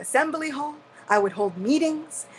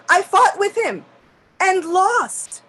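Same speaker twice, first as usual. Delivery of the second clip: rueful outburst; 7 semitones higher, 7 dB louder, slower than usual. Acted. Indra confessing his battle with Krishna.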